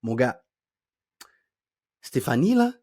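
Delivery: long pause before "Stéphanie"; suspenseful pauses. Shown as a demonstration. Friend holds back gossip to build suspense, long pauses. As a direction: stop dead after "Mon gars", then drop "Stéphanie, là?". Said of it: "comment j'ai utilisé des pauses".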